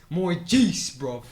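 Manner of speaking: Kool-Aid Man. Acted. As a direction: insist in hammed-up British. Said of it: "The Kool-Aid Man's, like, terrifying".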